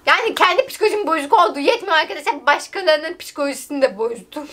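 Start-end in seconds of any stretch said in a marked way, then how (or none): none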